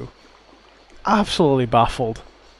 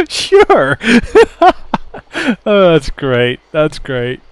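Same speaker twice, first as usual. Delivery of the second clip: much higher, louder sure through laughter; laughing sarcasm; 3 semitones higher, 5 dB louder, slower than usual. Real, not acted. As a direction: laugh it off mockingly. Laughing at character's reply.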